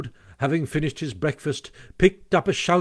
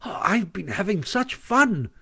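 higher, gleeful announcement; pleased with himself; 7 semitones higher, slower than usual. A narrator's reading. Mole tumbles in to tell his friends, pleased. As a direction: burst in delighted with yourself. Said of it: "evidently very pleased with himself".

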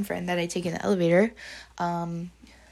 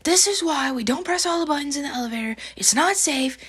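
high, strained scolding outburst; mock freak-out, pitched up; retold losing it; 8 semitones higher than usual. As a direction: imitate a panicked teacher telling a kid off. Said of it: "I lose my shit".

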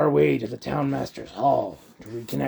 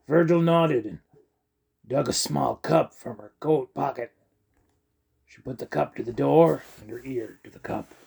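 ordinary delivery, nothing marked

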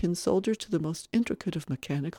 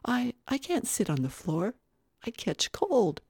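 put-on higher, hesitant child voice; anxious little boy; 7 semitones higher than usual. Little boy afraid of catching cold, hesitant. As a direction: refuse timidly, as a careful child.

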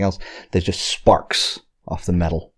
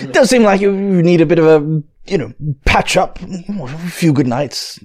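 put-on posh character accent; 8 semitones higher than usual. GM voicing noble character.

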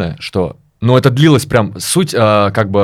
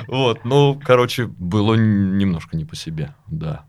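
ordinary talk

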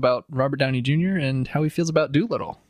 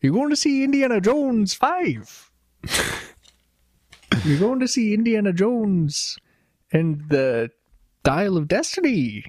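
put-on Midwestern accent; 7 semitones higher than usual. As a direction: lay on a sing-song Midwestern accent, much higher. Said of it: "What accent is this?".

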